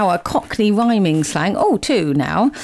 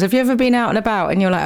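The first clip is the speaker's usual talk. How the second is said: ordinary delivery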